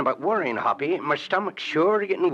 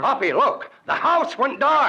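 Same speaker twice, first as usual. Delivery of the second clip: much higher and louder, urgent; alarmed shouting; 9 semitones higher, 4 dB louder than usual. Acted. Partners see the house go dark and shout in alarm. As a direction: raise the alarm.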